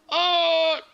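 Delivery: sudden high, loud yelp; startled oh. Real, not acted. Streamer caught by the wrong paint colour, startled yelp. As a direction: jolt at the mistake.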